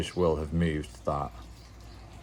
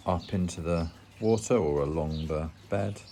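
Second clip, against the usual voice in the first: ordinary delivery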